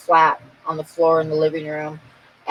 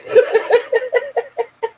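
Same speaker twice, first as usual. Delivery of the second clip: high burst of real laughter; a real laugh taking over. Real, not acted. Herself telling friends, bursting into high laughter.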